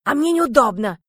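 indignant protest. A narrator's reading. Heroine objecting to Андрей lying on her, indignant. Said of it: "возмутилась я".

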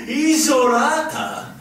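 laughing disbelief. Acted. Senior officer mocking the lieutenant's answer, laughing disbelief. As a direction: laugh the word back at him.

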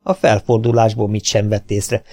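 ordinary delivery, nothing marked

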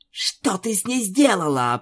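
ordinary delivery, nothing marked

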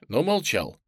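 ordinary delivery

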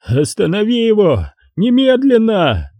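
high frantic scream, slowing on the last word; breaking into a scream. Acted. Хеннесси срывается на крик на Дантека, визгливый крик. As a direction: shriek the order. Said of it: "Хеннесси сорвался на крик".

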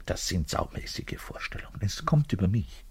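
ashamed whisper. Acted. Bass player whispers his lustful fantasies to the visitor. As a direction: confess it under your breath.

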